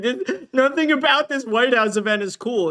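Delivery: high, sneering put-on whine; mocking whiny voice. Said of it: "He's like".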